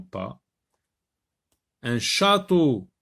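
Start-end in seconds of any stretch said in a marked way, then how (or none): 1.8-2.9 "château" said loud with its ch brought out; stressing the ch sound. Shown as a demonstration. Teacher showing pupils the sound, stressing ch. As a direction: bring out the ch in "château" clearly. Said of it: "Oui, j'entends le son ch".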